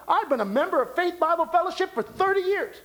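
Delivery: high, fast mock boast; voiced church member. Preacher acts out a member answering, high and quick boast. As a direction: rattle it off high as the proud member. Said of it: "Ask somebody".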